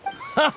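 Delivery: scoffing laugh. Acted. Merchant mocks rival's records.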